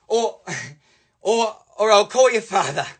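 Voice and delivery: high-pitched threatening mother, halting then firm; mum's threat. Retold or imitated. Mum warning her misbehaving kids, high scolding threat. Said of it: "Mum, she cracked it".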